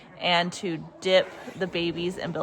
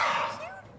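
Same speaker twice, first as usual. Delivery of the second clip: gasp; 5 dB quieter than usual. Real, not acted. Sees newborn is a girl.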